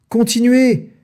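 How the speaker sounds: high, loud bark of a command; the farmer bellows at his men; shouted order. A narrator's reading. Boggis yells at Bunce and Bean to keep going. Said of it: "hurlait le gros Boggis".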